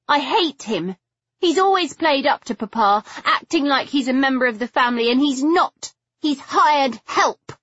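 high-pitched, bitter outburst; spitting contempt. A narrator's reading. Figgy denouncing her father's hired man, spitting contempt. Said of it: "fairly spitting the word".

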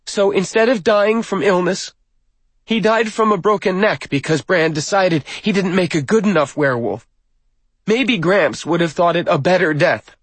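put-on high, loud young woman's indictment; bitter accusation. A narrator's reading. Granddaughter blames Bran for the death, loud bitterness. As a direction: ring it out bitterly from the pulpit. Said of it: "her bitterness apparently unchecked".

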